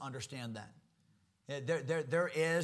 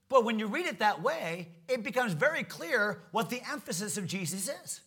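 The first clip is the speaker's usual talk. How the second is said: ordinary talk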